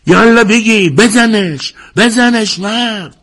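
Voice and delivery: very high, loud ringside cheering; slow drawn-out last bazanesh, yelled hard; shouting. A narrator's reading. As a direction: urge him on at the top of your voice. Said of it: "حد فریاد زد".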